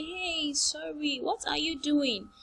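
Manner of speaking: high, soft acted sympathy; consoling. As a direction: soothe someone who is crying. Acted consoling of someone crying.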